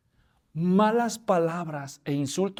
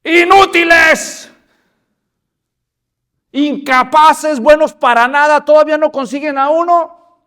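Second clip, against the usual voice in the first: loud scornful giant hurling insults; giant's taunting bellow; 9 semitones higher, 13 dB louder than usual. Acted. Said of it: "y de pronto se oye un".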